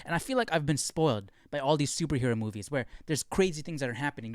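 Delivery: ordinary delivery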